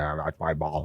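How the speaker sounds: low grumpy mock voice; imitated grumpy man. Imitating the stranger claiming the ball, grumpy. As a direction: grumble it like a sore loser. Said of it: "he was all grumpy about it".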